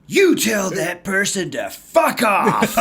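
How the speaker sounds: slow, loud and punchy, every word landed; emphatic curse. Retold or imitated. Old coach tells the speaker to dismiss a critic, slow and loud. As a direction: hammer out the curse like a verdict.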